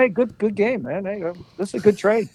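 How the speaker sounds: breezy team owner congratulating the new player; imitating Jerry Buss. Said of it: "There's Jerry Butts going".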